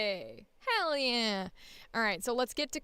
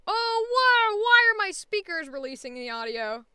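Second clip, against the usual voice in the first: startled; 9 semitones higher, 11 dB louder than usual. Real, not acted. Alarmed at speakers.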